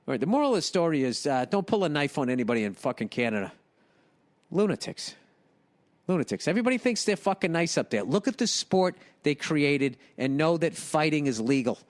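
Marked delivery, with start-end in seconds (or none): none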